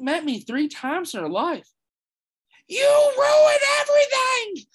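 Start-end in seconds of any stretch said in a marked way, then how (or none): 2.7-4.7 put-on high, loud accusing child yell; furious child shout. Little girl yells at him over the broken racket, furious high shout. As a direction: scream it as a betrayed little girl.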